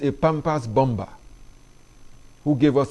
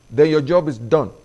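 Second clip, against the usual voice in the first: ordinary talk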